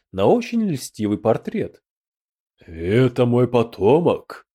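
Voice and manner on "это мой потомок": slow, ghostly voice from the grave; sepulchral voice. A narrator's reading. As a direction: speak like a dead ancestor from beyond. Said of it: "донёсся замогильный голос".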